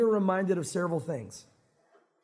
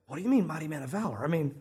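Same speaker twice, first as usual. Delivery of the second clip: incredulous; 6 semitones lower, 3 dB quieter than usual. Acted. Gideon doubts the angel's greeting.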